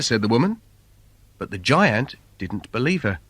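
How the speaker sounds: ordinary delivery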